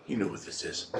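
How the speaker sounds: hushed conspiratorial whisper; whispered aside. Acted. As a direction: whisper it to the viewers.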